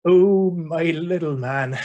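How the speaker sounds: put-on slow, drawn-out Suffolk accent; nan's Suffolk accent, words stretched out; thick Suffolk accent. Grandmother fondly addressing her grandson, thick Suffolk accent. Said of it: "said in her thick Suffolk accent".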